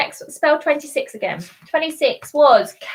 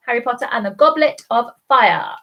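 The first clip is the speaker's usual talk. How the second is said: normal talking voice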